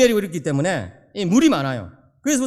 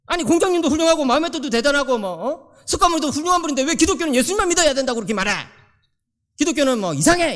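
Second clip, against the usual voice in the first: higher-pitched mock tirade of an indignant boy; arguing child; 6 semitones higher than usual. Son argues with his pastor father about Christianity, mock indignant. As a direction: press the complaint like a protesting child. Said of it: "이러면서 막 그러는 거예요".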